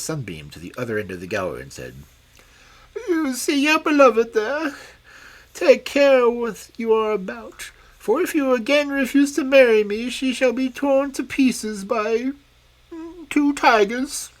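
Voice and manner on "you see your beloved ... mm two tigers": much higher, louder, strange fairy voice; a vain, scheming old fairy crone; shrill-free high hag of a fairy; wicked old fairy. Acted. Old fairy threatens prince.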